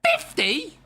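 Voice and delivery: very high incredulous "Fifty?"; shocked squeak. Real, not acted. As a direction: squeal it in disbelief at the price. Outraged at player's wage demand.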